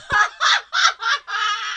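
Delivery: very high-pitched wordless cry; long, high comic wail; exasperated outburst. Acted. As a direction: squeal out at your own embarrassment. Loud high outburst after self-mockery.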